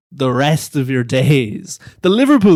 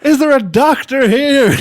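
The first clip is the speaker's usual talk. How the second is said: much higher, loud cry for help; hammed-up call for a doctor; mock alarm; 10 semitones higher, 6 dB louder than usual. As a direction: pretend emergency call.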